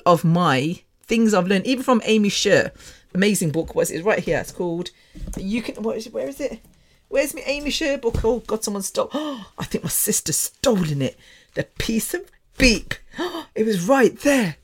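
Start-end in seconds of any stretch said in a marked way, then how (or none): 5.4-14.6 higher, softer, off to one side; searching aloud, then aghast; real dismayed surprise. Real, not acted. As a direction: gasp as you realise it's gone. Searches for book, finds it missing.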